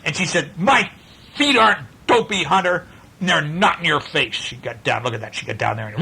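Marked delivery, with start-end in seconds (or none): 0.6-4.4 put-on high, indignant girl's retort; mad girl. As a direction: push back at your brother's insult. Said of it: "she got a mad eyes".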